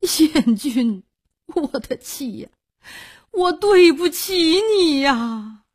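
high, grief-stricken cry; tearful wailing. Acted. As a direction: wail in remorse for a dead wife. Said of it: "哭叫道".

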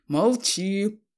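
loud silencing command; loud shout. A narrator's reading. Father silencing his student son, angry shout. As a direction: shout the other person down. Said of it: "крикнул на него отец и так громко".

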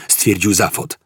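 usual speaking voice

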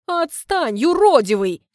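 a woman shoving off her suitor, loud and irritated; brushing him off. Acted. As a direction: wave him away with your voice. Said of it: "Отмахивается она".

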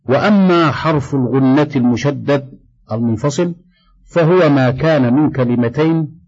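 usual speaking voice

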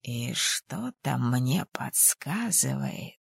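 slow, half-whispered persuasion; soft, drawn-out knowing hint; hushed coaxing. A narrator's reading. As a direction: drop to a whisper and tempt her slowly. Kosha quietly tempting heroine.

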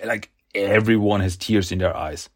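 ordinary talk

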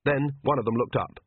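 ordinary delivery, nothing marked

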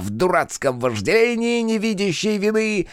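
high, lively verse recital; perky declaiming. A narrator's reading. Cheerful fool runs up reciting a rhyme, perky declaiming. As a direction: recite it in a high, cheeky singsong. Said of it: "Задорно продекламировал подбегающий".

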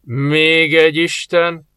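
slow, resounding exclamation; booming voice. Acted. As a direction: ring out from nowhere. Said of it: "zendült a hang a semmiből".

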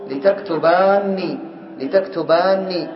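لتكتباني drawn out slowly with kasra lam "li"; slow demo form. Shown as a demonstration. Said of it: "إذا كسرنا اللام مثلًا هنا". (لتكتباني بكسر اللام ببطء)

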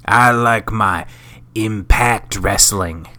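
slow, hammed-up Texas drawl; exaggerated mock Texas accent. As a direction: play a cowboy Texan griping about the WWE. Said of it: "just straight Texas".

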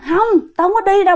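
high-pitched, insistent protest; defiant refusal. Retold or imitated. Great-grandmother resists the ghost pulling her, defiant refusal. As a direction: refuse to be dragged off.

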